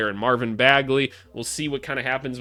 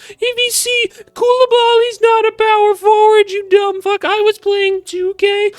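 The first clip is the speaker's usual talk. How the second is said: shrill squeaky mock voice, far higher and louder; mocking commenter; 10 dB louder than usual. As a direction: parody an angry clueless commenter yelling at the host. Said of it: "What's wrong with you?".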